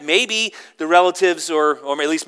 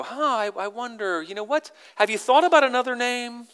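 higher, hesitant tactful wondering; put-on diplomatic hesitation; polite suggestion; 9 semitones higher than usual. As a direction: float a doubt politely, pitched up. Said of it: "don't even respond politely".

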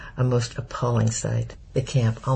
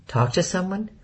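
puzzled echo; 7 semitones higher than usual. A narrator's reading. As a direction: Talk to someone? rising high on to, puzzled. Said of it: "Corey looked puzzled".

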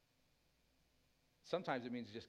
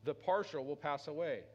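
loud, higher, fervent preaching voice; full-force preaching; 5 semitones higher, 5 dB louder than usual. Acted. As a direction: drive it home to the congregation.

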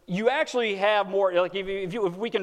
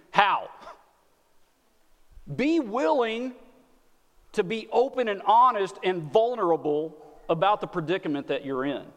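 ordinary talk

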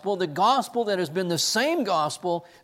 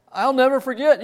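laughing; 9 semitones higher, 7 dB louder than usual. Retold or imitated. Laughing retelling of reply.

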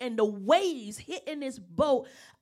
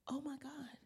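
whispered; 3 semitones higher, 13 dB quieter than usual. Retold or imitated. Remembered private exclamation.

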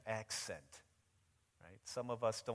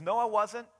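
loud, high, vehement acted denial; violent denial; 12 semitones higher, 16 dB louder than usual. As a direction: shout it like you're desperate to disown him. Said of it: "this time even more violently, says".

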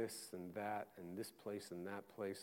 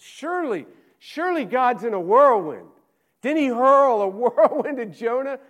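high, loud, ringing preacher's cry; full-force preaching; 13 semitones higher, 21 dB louder than usual. Acted. Preacher listing where God must surely be, preaching at full force. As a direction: build it like a revival climax, pushing hard.

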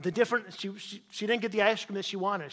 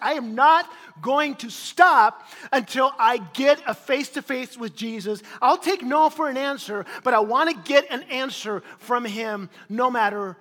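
determined; 3 semitones higher, 7 dB louder than usual. Retold or imitated. Bartimaeus's determined insistence.

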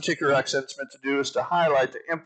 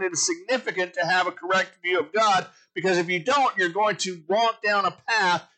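preaching build; 5 semitones higher than usual. Acted. Builds to an exhortation.

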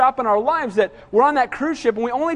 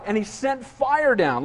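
usual speaking voice

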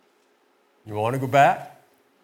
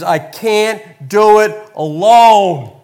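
slow, loud, drawn-out plea; despairing cry; 6 semitones higher, 10 dB louder, much slower than usual. Acted. Moses to God, breaking down and pleading. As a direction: cry it out at the end of your rope. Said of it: "Moses is having an emotional breakdown".

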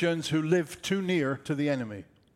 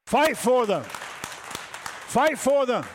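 rallying cry; 4 semitones higher, much slower than usual. Real, not acted. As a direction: urge the crowd on with force. Preacher rallies the congregation.

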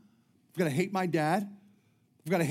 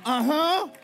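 high, loud knowing uh-huh; mock sassy uh-huh; 10 semitones higher, 8 dB louder than usual. Women at church mocking the baby-station idea, high sassy uh-huh. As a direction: play the unimpressed women. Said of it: "Girls like".

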